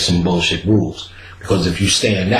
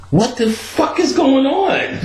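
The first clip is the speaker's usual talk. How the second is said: very high, slow disbelieving cry through laughs; panicked high; slower than usual. Retold or imitated. Realizing the edible hit, slow high panicked laugh. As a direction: stretch it out high and bewildered.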